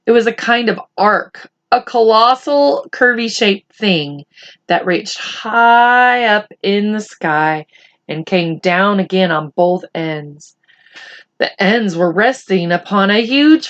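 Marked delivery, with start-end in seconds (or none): none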